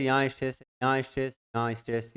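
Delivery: ordinary delivery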